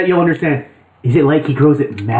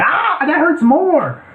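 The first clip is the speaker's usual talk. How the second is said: pained thug; 11 semitones higher, much slower than usual. Acted. Thug voiced in game scene.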